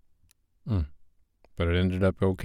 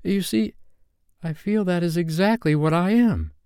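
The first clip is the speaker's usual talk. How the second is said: put-on high, earnest girlish voice; high-pitched, pleased little girl; Wendy's voice; 8 semitones higher than usual. A narrator's reading. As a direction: confide sweetly in a much higher pitch. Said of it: "Wendy said".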